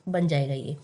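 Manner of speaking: ordinary talk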